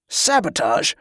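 sharp, disdainful query; incredulous distaste; disgusted question. A narrator's reading. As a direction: echo it with a curled lip. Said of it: "his lip curled in disgust".

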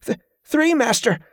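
high, strained and out of breath; a struggling boy gasps out the answer; panting boy. A narrator's reading. Boy panting while sparring.

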